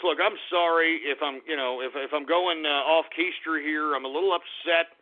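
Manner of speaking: ordinary talk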